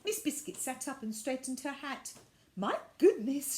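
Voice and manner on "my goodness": chuckling. A narrator's reading. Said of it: "she chuckled".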